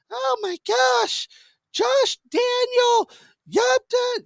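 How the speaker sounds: put-on high, slow shocked old-lady voice; horrified grandma. Grandma hearing the rap tape, mock horrified and high. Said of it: "she's like, obviously she's horrified".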